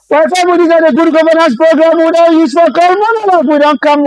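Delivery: punchy radio show opener; radio announcer. Acted. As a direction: call the show's name out to listeners, brisk and lifted. Reannouncing the show title.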